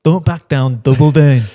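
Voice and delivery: loud, chanting egg-on. Real, not acted. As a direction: cheer him on to double down. Egging on louder.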